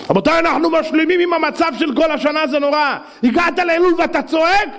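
high-pitched exhortation; worked-up, urgent sermon delivery; impassioned preaching. Real, not acted. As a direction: cry out a rebuke to the congregation.

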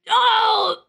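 high, sharp "oh" of disgust; high-pitched disgusted yelp; revolted cry. Real, not acted. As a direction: cry out at a foul smell. Reacting to a wet fart.

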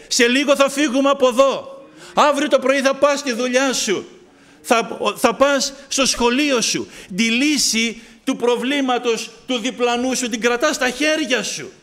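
loud, urgent exhortation; preaching at full force. Acted. Preacher urging the congregation to act. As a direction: press it on them loudly.

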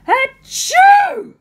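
loud high sneeze; fake sneeze. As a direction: shout a big cartoon sneeze. Princess sneezes in the story.

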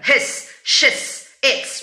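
"His, she's, it's" as short hissed syllables; swallowed vowel. Shown as a demonstration. Teacher drilling students on contractions, short and sibilant. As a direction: cut each vowel short, leaning on the final s. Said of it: "O apóstrofe come uma letra".

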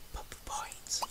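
very soft, voiceless whisper. Real, not acted. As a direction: whisper it secretively. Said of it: "I'm gonna whisper now".

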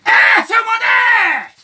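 loud, long-drawn call of the name; alarmed shout. Acted. Digger calls his mate at the dig, shouting in alarm. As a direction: holler it out, stretching the last vowel.

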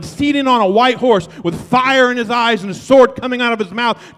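loud, driving fire-and-brimstone preaching; a pastor thundering a warning to his church; preaching at full force. Real, not acted. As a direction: preach it like judgment is at the door.

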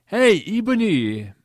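high, loud drawn-out holler; big hammed-up shout of the name; shouted call. A narrator's reading. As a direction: call out to a friend across the grounds. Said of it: "Ebony shouted a voice".

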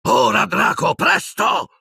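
very high angry shout; furious urging. A narrator's reading. Cruel-faced man rushes Draco, furious shout. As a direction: push him hard, climbing in pitch. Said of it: "ripeté rabbioso".

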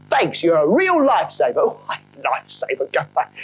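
a cheeky London trainee ghost; cockney spook. Acted. Spook character.

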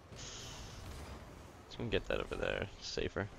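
slow, soft whisper to himself. Real, not acted. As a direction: breathe the words out quietly. Muttering to himself mid-game.

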